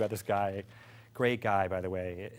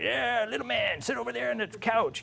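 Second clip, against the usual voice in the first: much higher lazy invitation; drunk editor; 12 semitones higher than usual. Retold or imitated. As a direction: wave the kid in, drunk and loose.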